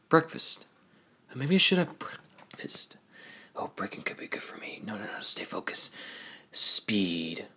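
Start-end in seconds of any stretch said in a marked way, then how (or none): 1.3-5.9 whispered, hurried self-coaching; muttered pep talk. Acted. Racer psyching himself up before a race, muttered. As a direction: talk to yourself under your breath.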